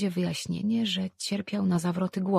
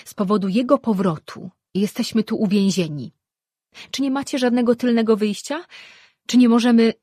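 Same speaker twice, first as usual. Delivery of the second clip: loud, raised-pitch complaint; agitated demand; 5 semitones higher, 9 dB louder than usual. A narrator's reading. Mother complains to daughter they are trapped here, agitated. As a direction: demand a way out, getting higher and louder.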